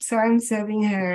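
ordinary talk, nothing marked

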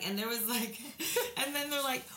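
ordinary talk, nothing marked

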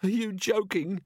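high, slow whimpering. A narrator's reading. Robber answering the police over growling, whimpering. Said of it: "whimpered someone".

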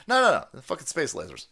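ordinary talk

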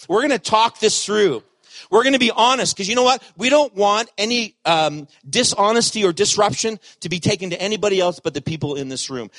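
emphatic preaching. Real, not acted. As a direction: preach it with rising force. Preacher building intensity.

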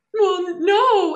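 high, loud weepy answer; mock tearful whine. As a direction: whimper it through tears. Sister asks if the book was sad.

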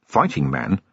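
low, doubtful repeat of "Fighting man?" with a lift at the end; incredulous echo. Acted. Vimes echoing incredulously.

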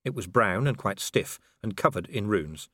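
ordinary delivery, nothing marked

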